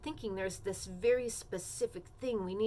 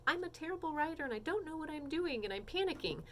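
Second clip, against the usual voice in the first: fast, higher-pitched imitated fretting; mock panicking student; 7 semitones higher, 3 dB quieter, faster than usual. Student confessing writing worries to the teacher, lightly acted panic. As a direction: rattle it off like a flustered student.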